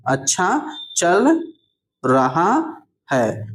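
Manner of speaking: अच्छा चल, long pause, then रहा है; अच्छा चल रहा है stretched out with gaps; slow dictation. Shown as a demonstration. Repeats word by word for learners.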